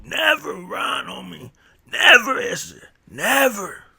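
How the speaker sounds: high, hammed-up shout of "never"; uncle's shout. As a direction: yell it like the old veterano drilling a kid. Uncle drilling never run.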